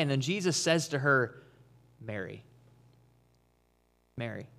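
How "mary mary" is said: soft, low Mary said gently; tender naming. Acted. Jesus softly calling Mary by name, low and hushed. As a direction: say her name quietly, like he knows her. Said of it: "he calls her by name".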